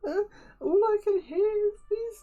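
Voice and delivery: high, loud, wailing creature voice; hammed-up, tearful character lament; despairing old wombat. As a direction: cry it out in a sustained high-pitched panic. Wombat despairs over trapped friend.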